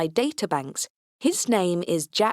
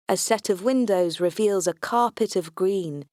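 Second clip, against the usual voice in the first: plain reading voice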